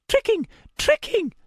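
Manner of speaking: a shrill, unhinged madman shrieking the word; high manic cackling voice. A narrator's reading. Madman mocking the narrator: high manic voice. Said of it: "then laughed, a high sound that made my hairs rise".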